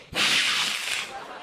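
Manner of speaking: long voiceless hiss; snake hiss. Retold or imitated. Snake jumping up at the camera click, long hiss. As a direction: imitate a startled snake hissing.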